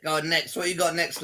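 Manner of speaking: normal talking voice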